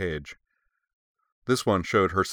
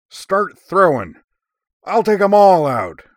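much higher, much louder, slow battle cry; commanding shout; 13 semitones higher, 13 dB louder, slower than usual. A narrator's reading. Major shouts orders at her wingmates, gleeful and loud. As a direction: roar it like a fierce warrior at bat. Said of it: "and commanded".

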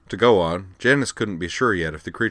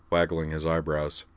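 usual speaking voice

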